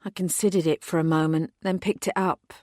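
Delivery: plain reading voice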